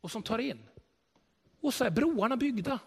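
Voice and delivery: normal talking voice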